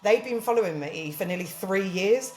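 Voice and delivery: ordinary delivery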